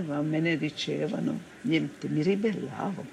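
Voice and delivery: ordinary talk, nothing marked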